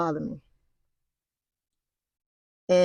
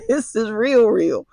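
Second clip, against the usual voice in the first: alarmed realisation; 5 semitones higher, 6 dB louder than usual. Retold or imitated. Realising the danger, retold.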